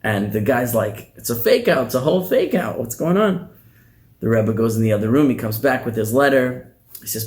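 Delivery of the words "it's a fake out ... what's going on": indignant disbelief. Acted. Messenger realizes it's a trick.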